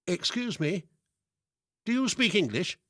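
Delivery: high-pitched, careful Yorkshire woman addressing a foreigner; Yorkshire lady's high, over-careful question; overpronounced Yorkshire accent. A narrator's reading. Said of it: "She had even said it very slowly, overpronouncing each word in her Yorkshire accent".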